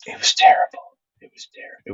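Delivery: soft unvoiced whisper; whispered verdict. Real, not acted. Telling chat how bad the show was, dismayed whisper. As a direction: breathe it out in dismay.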